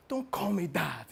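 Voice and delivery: urgent whispered warning; Sonny, hushing. Acted. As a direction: hush the client, softly under the breath. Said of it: "Sonny flipped".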